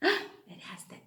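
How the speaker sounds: unvoiced whispered answer. Shown as a demonstration.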